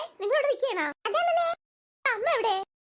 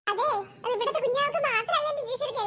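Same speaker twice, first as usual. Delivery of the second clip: normal talking voice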